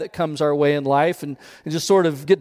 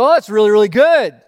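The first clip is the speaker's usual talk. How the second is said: loud, higher cheer of approval; hammed-up happy neighbor, louder; delighted neighbor; 4 semitones higher, 6 dB louder than usual. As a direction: cheer the farmer's good luck. Neighbor voiced delighted in parable.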